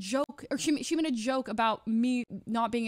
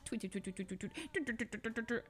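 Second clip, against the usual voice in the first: mock chatter; 10 dB quieter, faster than usual. Mocking chatters who judge.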